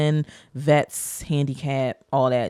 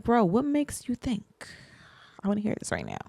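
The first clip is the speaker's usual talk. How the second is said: exasperated; 5 semitones higher, 6 dB quieter than usual. Retold or imitated. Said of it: "I'm like".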